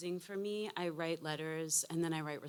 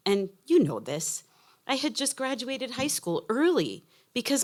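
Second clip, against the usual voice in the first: heartfelt letter reading; 3 semitones higher, 11 dB louder than usual. Acted. Mother's letter to her adult daughter, earnest reading. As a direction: speak as her late mother writing to her daughter.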